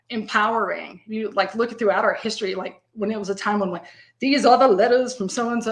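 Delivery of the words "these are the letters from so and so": louder, put-on Southern accent. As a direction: read it like an old Southern letter-writer. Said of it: "put a Southern accent on that".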